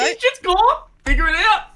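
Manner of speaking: high and loud; excited shout. Real, not acted.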